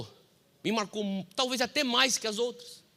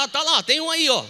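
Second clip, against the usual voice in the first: imitando a tia; 6 semitones higher, 6 dB louder than usual. Retold or imitated. Imitando a tia achando demônio.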